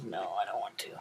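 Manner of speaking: low grumbling dog reply; voicing the dog. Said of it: "Oh, he says".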